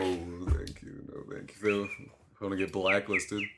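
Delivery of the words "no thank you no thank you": breathy whispered refusal, barely audible; whispered no thanks. Real, not acted. Teased about voiceover work, he whispers a dry refusal. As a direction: whisper it away, dryly declining.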